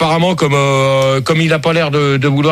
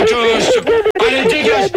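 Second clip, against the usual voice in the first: mock angry dismissal. Host chases the caller off.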